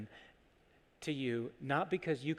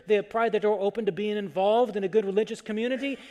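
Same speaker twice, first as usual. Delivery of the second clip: loud, raised preaching voice; forceful preaching; 6 semitones higher, 8 dB louder than usual. Real, not acted. Preacher on the strong who pried doors open, forceful preaching. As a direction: drive each repeated phrase home, louder and higher.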